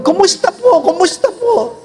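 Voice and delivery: doctor asking. Retold or imitated. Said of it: "Sabi ng doktor".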